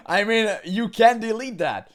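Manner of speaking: loud, high words through laughter; laughing. Real, not acted. Laughing loudly at the clip that can't be deleted. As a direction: laugh it out, mocking him.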